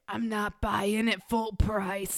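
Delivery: put-on slow, playful Scottish accent. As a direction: drawl it like a thrifty Scot. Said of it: "But are you Scottish?".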